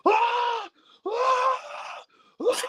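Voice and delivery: very high shouted grunts; imitated roar. Fletcher imitated roaring before the tiger driver, very high shouts. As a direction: yell it like a wrestler powering up. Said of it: "he hooks the arms and he goes".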